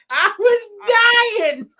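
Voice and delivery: high, loud, drawn-out cracking-up wail; helpless laughter. Real, not acted. Telling co-hosts about the clip, high hysterical wail. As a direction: wail it slowly as if dying of laughter.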